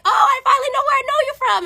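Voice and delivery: very high, sing-song fake realization; spring the trap in a high, chirpy voice; mock-sweet recognition. Server to cheating boyfriend at the table, mock-sweet. Pretending sudden recognition to expose him.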